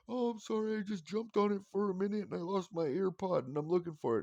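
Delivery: put-on high flustered child's voice; kid apologizing. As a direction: explain yourself like a nervous boy.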